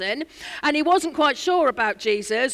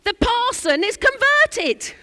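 ecstatic shout, much higher and louder; 11 semitones higher, 8 dB louder, slower than usual. Retold or imitated. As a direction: shout it out with joy like a revival cry. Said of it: "he shouted out in Cornish fashion".

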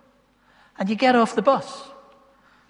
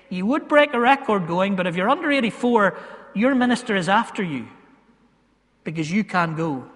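ordinary delivery

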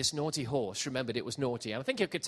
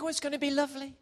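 dreamy naive believer; 8 semitones higher, 6 dB quieter than usual. Retold or imitated. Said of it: "And we think".

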